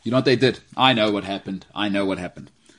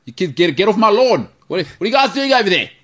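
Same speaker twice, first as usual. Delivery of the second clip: put-on grumpy old man; 8 semitones higher than usual. Teasing James as a future old man scolding kids. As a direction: yell at the kids like a fussy old neighbour. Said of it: "you're gonna be complaining about".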